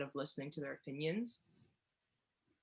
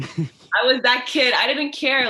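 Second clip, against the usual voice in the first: loud, slow and proud, pitched higher; defiant boast; 5 semitones higher, 11 dB louder, slower than usual. Retold or imitated. Owning her rebel past to her cohost, loud and defiant. Defiant kid talking back.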